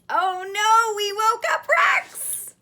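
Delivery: high loud oh no; alarmed. Acted. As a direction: panic playfully. Dramatic alarm.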